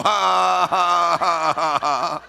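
high, loud, drawn-out ha-ha; defiant demonstrated laugh. Shown as a demonstration. Answering the devil about paying off the house, forced laugh. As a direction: laugh high and hard, on purpose. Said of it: "You go".

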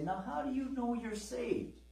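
lightly voiced skeptic pressing for proof; doubting questioner. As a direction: needle them with the how. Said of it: "and they say".

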